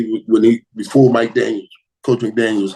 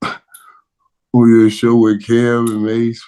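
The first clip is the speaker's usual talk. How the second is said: ordinary talk, nothing marked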